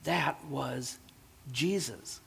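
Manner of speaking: put-on an old man talking softly to himself. A narrator's reading. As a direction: say it quietly in wonder. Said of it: "said the old man to himself".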